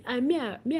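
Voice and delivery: ordinary talk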